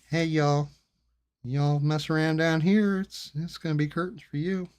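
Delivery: friendly host promising doom, y'all; polite southern threat. Retold or imitated. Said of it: "they'll say it to you in a very polite way".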